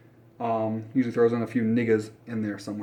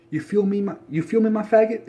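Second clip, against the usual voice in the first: mocking street slang; 7 semitones higher than usual. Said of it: "that's basically what it sounds like to me".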